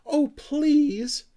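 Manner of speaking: high, loud begging squeak; pleading. Acted. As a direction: beg for it with all your heart. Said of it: "entreated Sniff".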